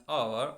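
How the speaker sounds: "our" starting straight on the vowel; silent H. Shown as a demonstration. Teacher showing how H O U R sounds, "our" with silent H. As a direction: say "our" with no H at the front. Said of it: "like its pronunciation our".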